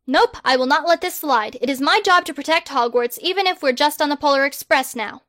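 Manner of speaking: loud, grand, self-important; pompous boy wizard. Acted. Harry the Great loftily turning down a survey, loud and pompous. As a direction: proclaim yourself like a hero.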